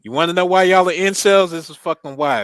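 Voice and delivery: loud, high mock outrage; hammed-up angry rant. Ranting at pineapple votes.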